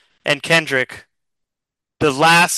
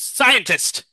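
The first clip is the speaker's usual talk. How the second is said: alien call; 7 semitones higher than usual. Acted. Chameleon calls the scientist.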